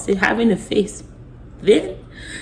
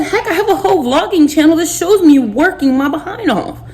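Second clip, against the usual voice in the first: raised, higher indignant voice; firing back at a lying husband, indignant and high; retold angry retort; 7 semitones higher than usual. To her husband, voice climbing in indignation. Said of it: "I said".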